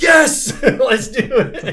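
loud laughing cheer; excited cheer. Retold or imitated. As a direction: shout yes like a rowdy crew. Crew cheers to moon the ship.

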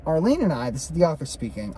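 ordinary delivery, nothing marked

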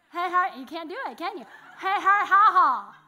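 loud, high vowels with the jaw held open; mouth-open demo. Shown as a demonstration. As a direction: show them what paper sounds like with an open mouth. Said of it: "try to say paper with your mouth open".

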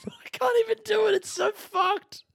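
squealing high through real laughter; can't get it out for laughing, pitch shooting way up; breaking up laughing. Real, not acted. Comedian cracking up at his own crude bit, squealing with laughter.

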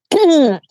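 hoarse clearing of the throat. Real, not acted. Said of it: "Sorry, I'm losing my voice".

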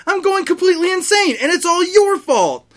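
high, loud, half-laughing rant; hysterical shout. Acted. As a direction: lose it completely at the headmaster. Snape's hysterical outburst.

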